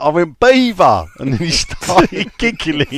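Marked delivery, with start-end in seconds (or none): 0.4-1.1 loud repeat. Retold or imitated. Repeating the word to his mate.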